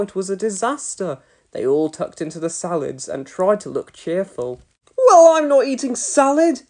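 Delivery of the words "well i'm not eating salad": put-on high, loud stubborn boy; insisting. A narrator's reading. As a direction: dig your heels in. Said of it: "insisted Norman".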